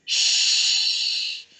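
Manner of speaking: sharp whispered shh; urgent hissing shush; hissed shush. A narrator's reading. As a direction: hush the child quickly. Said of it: "The mother hissed".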